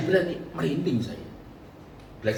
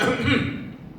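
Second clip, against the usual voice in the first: high imitated ahem; signalling throat clear; 9 semitones higher than usual. Husband signalling his wife before guests, throat clear. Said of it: "Om kan bilang ahem".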